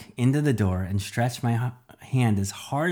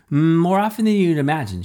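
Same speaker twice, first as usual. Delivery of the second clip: shot back; 7 semitones higher, 7 dB louder than usual. A narrator's reading. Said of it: "she shot back".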